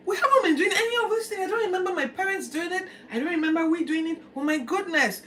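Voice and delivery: high, loud, fast cry of a shocked king; the king reels at what the scroll says; alarmed king. Acted. King reading the lost scroll, high and alarmed.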